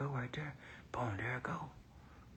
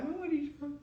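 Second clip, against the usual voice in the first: quiet, much higher, as if catching himself; startled inner voice; 6 dB quieter, faster than usual. Retold or imitated. Startled by his own tears, asking himself quietly. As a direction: ask yourself in disbelief, hushed and high. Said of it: "I'm like".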